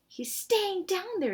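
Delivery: high, slow astonished aside; can't believe what he sees; disbelief. Acted. Dink surprised, whispering to Ruth.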